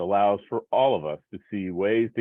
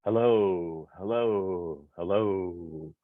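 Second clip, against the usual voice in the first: soft echo; 2 semitones lower, much slower than usual. Pretend. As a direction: echo fading softer with each hello. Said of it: "the soft echo of my voice".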